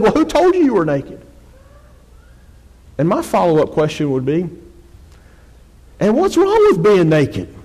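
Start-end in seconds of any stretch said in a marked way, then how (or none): 0.0-1.2 high-pitched challenging question. Acted. God questions Adam hiding in the garden, high challenging voice. 6.0-7.5 high, loud, drawn-out challenge; mock incredulous question. Preacher poses his follow-up to God, mock incredulous and high.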